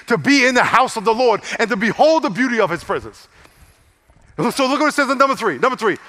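loud, high-pitched sermon delivery; full-force preaching. Real, not acted. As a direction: preach it loud, driving the point home.

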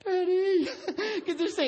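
mock-scared little boy squeal, very high; panicked child. Son begging dad to take the snake, mock panic. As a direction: imitate a kid's high, panicky plea.

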